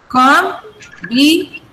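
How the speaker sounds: Combination in four slow separate beats; syllable by syllable. Shown as a demonstration. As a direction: break it up as com, bi, nay, shun. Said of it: "There are four syllables here".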